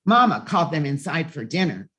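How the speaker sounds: plain reading voice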